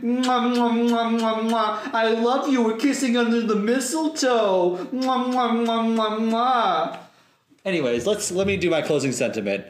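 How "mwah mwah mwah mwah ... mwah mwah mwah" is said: between kisses. Pretend. Kissing under the mistletoe.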